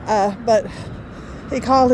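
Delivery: usual speaking voice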